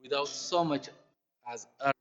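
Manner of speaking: warm, steady storytelling read; story reading. Acted. Preacher reading a story about mothers to the church, warmly. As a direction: tell the tale to the congregation with feeling. Reads story aloud to congregation.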